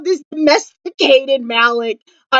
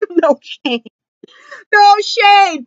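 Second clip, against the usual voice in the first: playful no shade; 7 semitones higher, much slower than usual. Real, not acted. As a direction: throw it off with a laugh. Defensive joke after Oscar dig.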